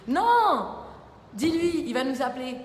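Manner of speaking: loud, high cry of refusal; protesting shout. Real, not acted. Protests, calling to someone off mic. (cri de protestation, fort et aigu)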